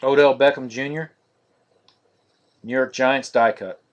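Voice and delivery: normal talking voice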